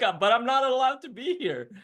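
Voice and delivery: high, giggling nervous objection; laughing protest. Retold or imitated. Student telling his master he isn't allowed, high laughing. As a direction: laugh through a timid protest.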